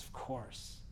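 very soft, almost whispered reply; hushed, low retold yes; tender assent. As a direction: grant it gently, under the breath. Jesus tenderly grants her plea.

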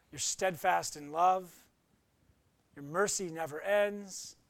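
a lightly voiced closing prayer. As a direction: pray gently for the congregation.

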